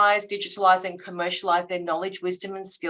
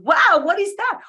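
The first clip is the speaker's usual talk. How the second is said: high, loud impressed wow; excited wow; 10 semitones higher, 7 dB louder than usual. Retold or imitated. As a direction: gasp it out, really impressed. Dinner guest reacts to the pitch.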